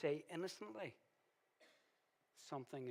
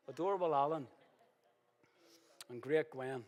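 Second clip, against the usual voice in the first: loud playful; 9 dB louder than usual. Real, not acted. Playful renaming after joke.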